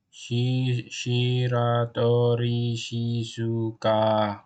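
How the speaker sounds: slow, evenly drawn-out syllables. Acted. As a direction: spell the name out slowly, holding each syllable. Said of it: "She's spelling it slowly or".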